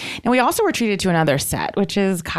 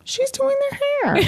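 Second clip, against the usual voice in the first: mock. Joking about pony groomer.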